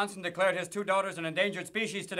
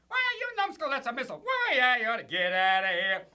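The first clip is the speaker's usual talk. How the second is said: much higher, squeaky stooge yell; 6 semitones higher than usual. Acted. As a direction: bark it like a slapstick stooge. Stooge voice after Three Stooges.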